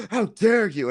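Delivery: hammed-up outrage; imitating a scandalised retort; mock indignant. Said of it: "they get all indignant".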